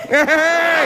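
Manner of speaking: high startled hey; mock startled. The actresses behind him reacting, mock startled. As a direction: jolt back in surprise. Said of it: "They're like".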